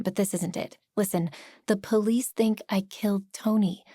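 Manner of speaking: ordinary delivery, nothing marked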